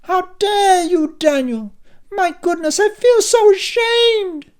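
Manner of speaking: very high, loud, slow, theatrical wail; soprano outrage. A narrator's reading. Mother shrieking in soprano at her shameful son. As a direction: rise to soprano in mock shame. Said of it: "her voice rose a couple of octaves to soprano".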